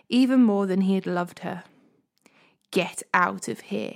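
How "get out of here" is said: slow, cold snarl of contempt; snarling at Tamar to leave. A narrator's reading. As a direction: spit it out with hatred, slowly. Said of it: "he snarled at her".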